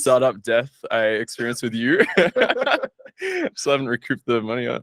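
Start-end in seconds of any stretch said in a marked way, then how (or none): 2.1-2.9 laugh. Real, not acted. Laughing about failed startup.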